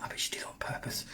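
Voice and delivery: whispered aside. Real, not acted. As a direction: let the viewers in on a secret. Joking aside about his wife.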